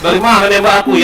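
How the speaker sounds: high, sharp surprised question; startled. Real, not acted. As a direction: caught off guard by a shot. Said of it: "Kaget aku".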